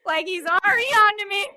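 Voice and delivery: real giddy excitement at being caught; amused outburst; excited. Real, not acted. Laughing at being found out.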